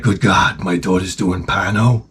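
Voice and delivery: low-pitched shocked father; mock-grave low disbelief; George C. Scott. Father learning of his daughter from a pimp, low mock shock. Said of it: "And he's like".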